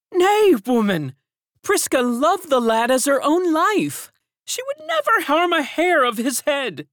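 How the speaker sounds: high, loud firm woman's voice; indignant servant. A narrator's reading. Servant defends the nurse to the others, high and loud. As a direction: defend her firmly.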